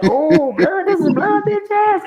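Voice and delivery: high mocking glee at spotting the snitch; excited recognition. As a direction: crow it like you just caught him. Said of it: "He was like".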